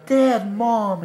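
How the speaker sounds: high, loud calling out; imitated child's call. Sick boy to his parents after five days, calling out.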